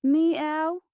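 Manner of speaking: high, plaintive cat mew; sad meow. A narrator's reading. Scruffy cat appeals to Winnie, sad meow. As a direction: mew pitifully like a stray cat. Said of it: "it said sadly".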